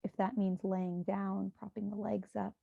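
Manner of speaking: hushed, even meditation guidance; guided meditation. Acted. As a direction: guide listeners gently into breath and body.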